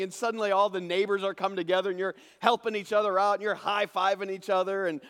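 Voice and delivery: loud, high, driving sermon voice; preacher at the peak of joy; full-force preaching. Acted. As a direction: preach the harvest triumphantly.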